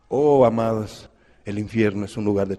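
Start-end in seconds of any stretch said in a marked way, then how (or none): none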